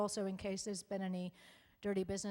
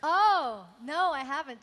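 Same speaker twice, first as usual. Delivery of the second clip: amused exclamation; 7 semitones higher, 15 dB louder than usual. Real, not acted. Reacts to fanfic question.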